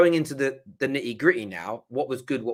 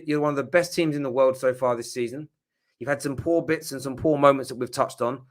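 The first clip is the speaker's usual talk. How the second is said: ordinary delivery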